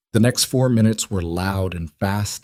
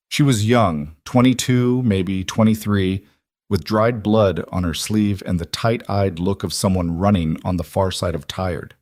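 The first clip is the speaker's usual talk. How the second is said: plain reading voice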